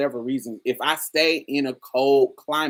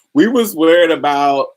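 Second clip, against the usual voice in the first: loud emphatic; 4 semitones higher, 12 dB louder than usual. Real, not acted.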